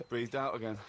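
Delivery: low, deadpan diarist; mock diary entry. Co-host parodying his friend's over-detailed diary, mock diary entry. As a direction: drop low and report the trivial as news. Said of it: "get to the point where you're going".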